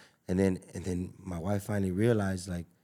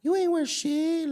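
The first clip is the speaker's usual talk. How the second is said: mocking woman's voice; 4 dB louder, slower than usual. Voicing a woman who belittles.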